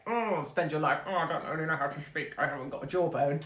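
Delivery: mock tongue-tied footballer voice; put-on inarticulate mumbler, struggling to speak; Harry Kane. Fan mocking a striker who can't speak, put-on inarticulate voice. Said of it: "Harry Kane can't speak".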